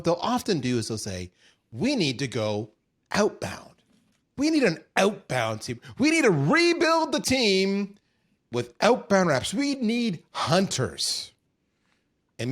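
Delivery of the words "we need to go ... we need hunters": bossy executive. Retold or imitated. Company bosses demand outbound hunters.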